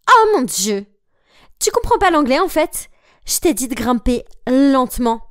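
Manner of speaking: cri aigu et exaspéré, fin plus lente; éclate de rage contre la coéquipière; furious outburst. Acted. Anna pète les plombs. (Anna crie sur Mandy après la chute, furieuse)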